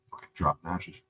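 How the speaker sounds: ordinary delivery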